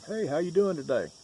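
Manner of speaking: high, bright call to a passerby; cheerful greeting. Retold or imitated. Passerby greeting a stranger on the sidewalk, high and cheery. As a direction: sing out a hello to a stranger.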